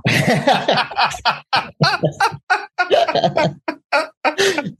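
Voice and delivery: long high laugh that falls away; real burst of laughter, tailing off; helpless laughing fit; big laugh. Real, not acted. Laughs at Mary's deadpan reply.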